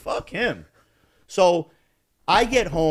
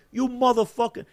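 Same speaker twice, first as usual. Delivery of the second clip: retold angry confrontation; 11 semitones higher than usual. Driver jumping out at his rival, angrily indignant. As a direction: fling out the curse, pitched way up.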